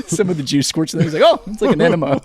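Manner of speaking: real laughter breaking into the words; laughing while talking. Real, not acted. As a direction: crack up through the joke. Hosts cracking up at crude joke.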